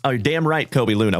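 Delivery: ordinary delivery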